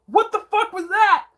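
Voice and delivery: very high squealed question; incredulous, voice leaping way up; disbelieving outburst. Real, not acted. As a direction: squeal it in total bafflement. Repeats disbelief after describing scene.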